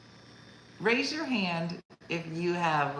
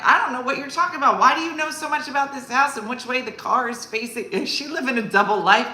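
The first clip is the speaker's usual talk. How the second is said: higher, louder and fast bafflement; exasperated; 7 semitones higher, 6 dB louder, faster than usual. Retold or imitated. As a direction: throw your hands up in confusion. Exasperated retort to mom.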